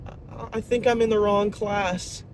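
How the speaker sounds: high, timid imitation of a student; freaking out. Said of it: "the kid's literally freaking out".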